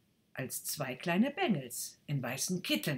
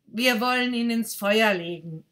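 put-on louder boy with a plan; 6 semitones higher, 11 dB louder than usual. Second boy proposes burning the stick, louder. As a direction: matter of fact.

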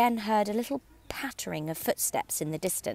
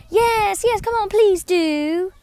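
much higher, louder, slow pleading chorus; chorus of animals; 13 semitones higher, 8 dB louder, slower than usual. A narrator's reading. The others join in chorus, high pleading voices. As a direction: beg in a high crowd voice. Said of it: "all joined in chorus".

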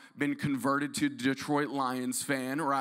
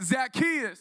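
higher, sing-song Zacchaeus; louder call; 7 semitones higher than usual. Shown as a demonstration. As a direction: call out Zacchaeus, leading the crowd. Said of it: "we could do better than that".

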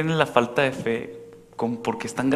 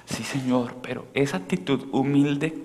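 usual speaking voice

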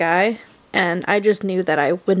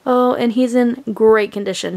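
ordinary talk

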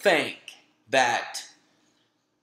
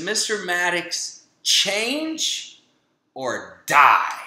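stern doctor's warning; 3 semitones higher, much slower than usual. Retold or imitated. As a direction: hammer home the ultimatum.

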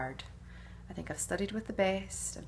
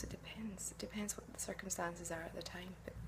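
whisper; 12 dB quieter than usual. Acted. Coy aside about Rowena's plans.

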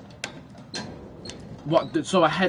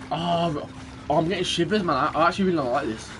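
usual speaking voice

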